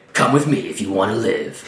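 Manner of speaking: slow, lightly voiced action-hero line; mock action hero. Player reaching out to rescue Worrell, mock action hero. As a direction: deliver it slowly as a mock-dramatic rescue line.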